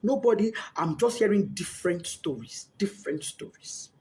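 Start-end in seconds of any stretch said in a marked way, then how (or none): 1.5-3.9 whispered despair. Real, not acted. Voice fading to breath.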